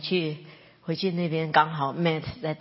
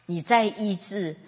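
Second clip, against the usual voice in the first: ordinary talk, nothing marked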